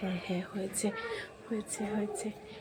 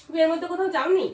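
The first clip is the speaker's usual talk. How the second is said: accusing; 3 semitones higher than usual. Acted. Fake jealous quarrel.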